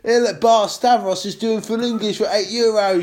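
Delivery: excited tourist spotting cheap breakfast; British expat voice. Pretend. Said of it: "expat living in Cyprus".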